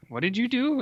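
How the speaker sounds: high-pitched retold disbelief; mock appalled outcry. Francis confronts monk over the pig, mock appalled. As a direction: cry out in pretend dismay.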